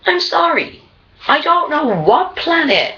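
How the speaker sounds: usual speaking voice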